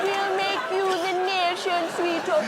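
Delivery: high-pitched mock Geordie; bad Geordie accent. Making [name] the nation's sweetheart, in a bad Geordie accent. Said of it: "I can't do a Geordie".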